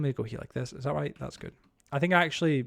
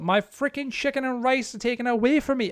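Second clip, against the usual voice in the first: much higher mock-indignant wail; mock outrage; 8 semitones higher, 4 dB louder than usual. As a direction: play it as fake tragedy over lost rice. Mock complaint about the keto diet.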